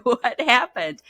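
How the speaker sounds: bewildered and half laughing; laughing disbelief. Retold or imitated. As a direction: client baffled her craving is gone, laughing in disbelief. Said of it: "and she goes".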